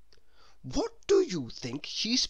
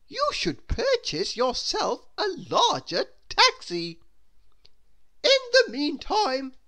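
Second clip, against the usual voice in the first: haughty grand lady; 12 semitones higher, 5 dB louder than usual. Acted. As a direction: dismiss money like royalty. Miss Splendid haughty.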